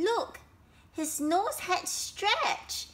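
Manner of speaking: high, slow astonishment; shocked discovery. Acted. Elephant discovers his nose has stretched, shocked. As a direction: draw it out, pitched high.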